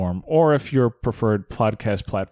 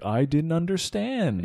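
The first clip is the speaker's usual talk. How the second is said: slow, higher, drawn-out pretend innocence; feigned incomprehension; 7 semitones higher than usual. Co-host voicing the ambassador playing dumb, feigned incomprehension. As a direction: play dumb, stretching the words. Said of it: "So he can go like".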